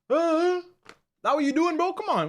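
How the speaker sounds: high, loud outraged hey; indignant disbelief. Real, not acted. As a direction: call out the chat in shocked disbelief. Reacting to a chat message.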